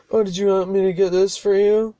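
imitating a passenger's sarcastic offer. Passenger sarcastically offers to get the CDs, mock sarcastic. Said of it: "Like, sarcastically".

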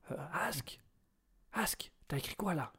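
off-mic whisper. Real, not acted. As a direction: whisper it to your co-host. Off-air aside whispered.

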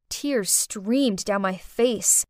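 usual speaking voice

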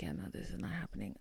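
fast breathy whisper of disbelief; inner thought whispered. Retold or imitated. Thinking it at the car dealership, quick whisper. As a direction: mutter it under your breath, quick. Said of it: "I'm like".

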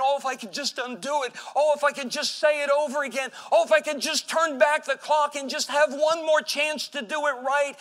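building from plea to anguished cry; anguished regret. Acted. Regretful sinner longs to undo it, building anguish.

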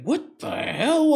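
high, drawn-out mock disbelief; retold bafflement. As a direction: replay your baffled reaction, stretched out. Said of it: "I was just like".

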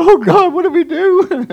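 high-pitched mock whimper; comic panicked prayer; crying to God. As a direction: plead helplessly in a hammed-up high voice. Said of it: "going to the Lord to cry".

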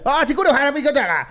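voz muy aguda, burlona; otaku gritando japonés. Acted. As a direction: chilla japonés inventado imitando anime. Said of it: "y escucha". (parodia aguda de chica anime)

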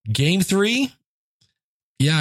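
normal talking voice

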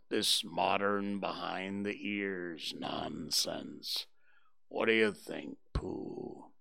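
low, slow, whispered gloomy grumble; grumbling Eeyore. A narrator's reading. Eeyore scorns washing and asks Pooh, low and slow. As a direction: sneer at the fuss in a dry, breathy mutter. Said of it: "grumbled Eeyore".

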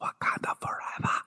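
breathy, soft whisper; Okoye's hushed Wakanda forever. Retold or imitated. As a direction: whisper it reverently. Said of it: "Okoye going".

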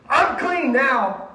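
high, loud, drawn-out proclamation; stretched-out happy call; elated announcement. Retold or imitated. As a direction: announce it to everyone you meet. Healed leper telling everyone.

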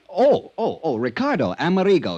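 catching himself as the name comes back; flustered realization of the name; sudden recall. Acted.

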